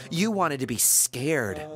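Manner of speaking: usual speaking voice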